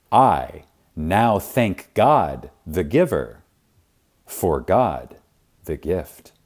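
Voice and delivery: each word laid down slowly, weight on God; slow, deliberate affirmation. Shown as a demonstration. Reader repeats the affirmation slowly for the listener. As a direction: say it again slowly so it sinks in.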